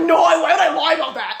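high, loud, laughing mock shout; imitating him pissed. Man called out at the club, mock-furious. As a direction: yell it in hammed-up outrage. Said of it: "pissed that I called him out".

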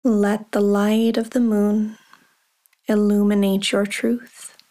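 ordinary delivery

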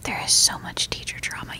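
conspiratorial gossip whisper; giggly prank whisper. Pretend. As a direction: hush it like a secret. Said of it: "Stop whispering into the mic".

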